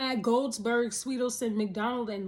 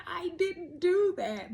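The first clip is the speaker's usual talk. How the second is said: mock protest; 8 semitones higher, slower than usual. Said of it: "it's just like".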